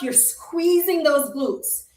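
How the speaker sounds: usual speaking voice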